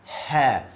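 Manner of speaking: 'Ha' like the H in house; Arabic letter Ha. Shown as a demonstration. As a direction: breathe a plain English H in 'Ha'. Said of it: "like H in English".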